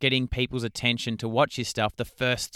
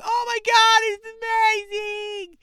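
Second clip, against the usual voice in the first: very high, loud mock fan squeal; gushing fans; 6 dB louder, slower than usual. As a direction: gush like an over-the-top fan, stretching the words. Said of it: "people basically falling over herself going".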